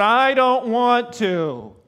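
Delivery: slow, high, loud whine; hammed-up child's whine; whiny kid. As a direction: drag it out like a sulking child. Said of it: "the kids are going".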